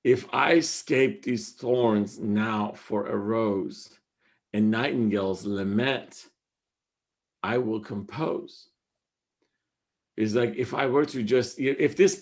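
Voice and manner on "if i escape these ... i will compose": slow, lower, hushed reading; poem recital. Acted. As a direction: savour each phrase of the couplet. Recites couplet, slow and low.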